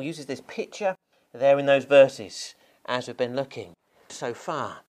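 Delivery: normal talking voice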